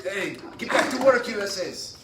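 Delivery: a joking boss voice; bossy foreman. Pretend. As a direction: order the workers back like a pushy boss. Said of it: "I was trying to be like the, y'all's boss".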